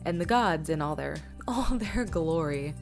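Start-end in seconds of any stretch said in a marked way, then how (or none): none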